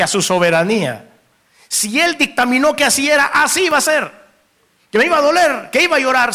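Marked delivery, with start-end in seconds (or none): 1.7-6.3 raised-pitch sermon warning; urgent pulpit exhortation; fervent preaching. Real, not acted. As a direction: press the warning on the congregation, drawing out key phrases. Preaches on accepting God's will.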